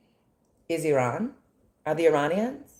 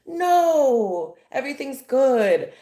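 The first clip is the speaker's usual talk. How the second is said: high, loud mock-cheerful employee; hammed-up forced cheer; fake happy; 6 semitones higher, 4 dB louder than usual. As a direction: pretend all is wonderful, sarcastically over-bright. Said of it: "pretend to be happy employees".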